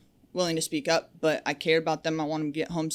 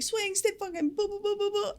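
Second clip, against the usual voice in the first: much higher cartoonish cursing gibberish; mock angry babble. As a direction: imitate a boss losing it in nonsense. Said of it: "He's like".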